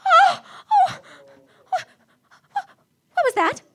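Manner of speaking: high, loud gasping fright; frightened cry at the click; startled fright. Acted. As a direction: jump at a sudden sound. Hears a click on line.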